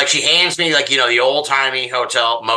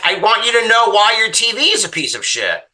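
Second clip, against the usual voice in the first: exasperated rant; 10 semitones higher than usual. Retold or imitated. Ranting at motel owner.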